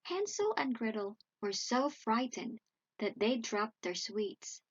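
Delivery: plain reading voice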